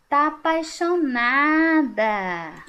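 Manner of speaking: "apaixonada" without the i sound, slow; dropped i. Shown as a demonstration. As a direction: say "apaixonada" as "apachonada", swallowing the i. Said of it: "Olha bem como que ela falou".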